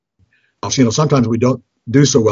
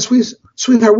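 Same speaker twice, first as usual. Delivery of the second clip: high-pitched mock pleading; acted pained appeal; dismayed protest; 11 semitones higher, slower than usual. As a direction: plead with your spouse in mild exasperation. Pastor protests to wife over price.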